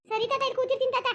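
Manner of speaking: put-on high, childlike promise to wait; a small girl obediently agreeing to stay put; little girl voice. Granddaughter tells grandfather she will wait, in a high child voice.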